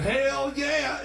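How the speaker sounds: high, loud eager "hell yeah"; mock hitchhiker yell. Hitchhiker answering Josh's offer of a ride, with a high, loud mock yell. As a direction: belt it out like she can't wait to get in.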